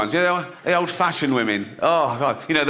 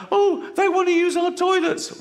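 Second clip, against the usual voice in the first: mocking old-fashioned woman; 13 semitones higher than usual. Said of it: "they go like".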